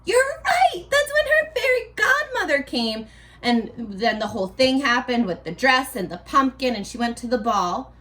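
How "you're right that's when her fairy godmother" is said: much higher, bright praise; teacher praising a student's answer over video; delighted praise. Shown as a demonstration. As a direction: cheer the kid's answer with real delight. Praising a child's imagined answer.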